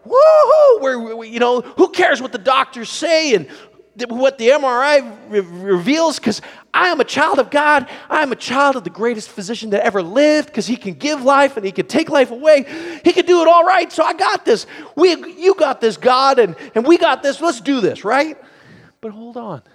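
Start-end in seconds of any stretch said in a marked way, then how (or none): 0.0-0.8 loud, high whoop of joy; excited whoop. Acted. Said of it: "we get all excited and we're like". 0.8-18.4 loud, high-pitched rush of confidence; fired-up believer proclaiming faith; excited bravado. Acted. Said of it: "we get all excited".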